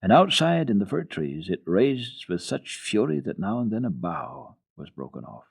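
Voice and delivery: usual speaking voice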